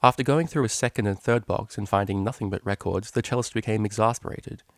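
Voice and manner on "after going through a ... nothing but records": ordinary delivery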